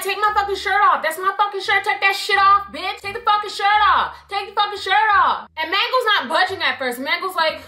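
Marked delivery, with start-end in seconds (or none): 0.0-5.5 high-pitched, relentless mock demands; imitated angry barking. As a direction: bark repeated orders, mimicking an angry girl. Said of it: "she was barking at you".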